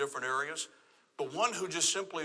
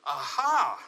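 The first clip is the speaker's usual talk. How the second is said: louder, retold aha moment; an emphatic, re-enacted eureka; sudden realization; 5 dB louder than usual. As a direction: act out the penny dropping, with more volume.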